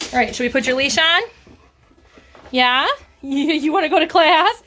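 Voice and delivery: high, fast and bright; asking the puppy about class; excited puppy talk. Real, not acted. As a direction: hype the dog up. Talking to puppy.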